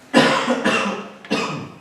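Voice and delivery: real coughing fit. Real, not acted. Teacher breaks off question to students, coughing. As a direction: cough a few times mid-lesson.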